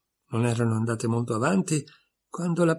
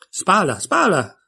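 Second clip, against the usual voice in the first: loud, high repeated call of feather; the sheep shouts at Alice rowing, high and loud; exclaimed; 6 semitones higher, 5 dB louder, slower than usual. A narrator's reading. Sheep calls out to Alice at the oars, loud and high. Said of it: "esclamò ancora una volta la pecora".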